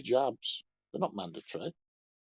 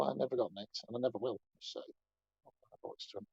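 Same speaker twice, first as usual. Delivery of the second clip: usual speaking voice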